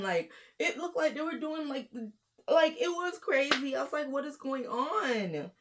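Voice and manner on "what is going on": high, drawn-out incredulous question; retold disbelief. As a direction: stretch it out in high-pitched disbelief.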